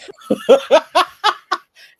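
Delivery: loud, high disbelieving laugh; high cackle of disbelief. Real, not acted. Laughs at the story.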